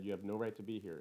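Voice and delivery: ordinary delivery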